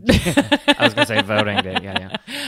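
high laughing words. Real, not acted. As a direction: laugh through the line. Laughing at own joke.

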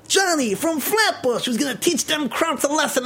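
put-on high-pitched wartime movie Brooklyn accent; hammed-up Brooklyn tough-guy soldier; old-film Brooklyn accent. Johnny threatening the enemy in propaganda film, Brooklyn accent. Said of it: "the New York accent is a Brooklyn accent".

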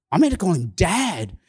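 normal talking voice

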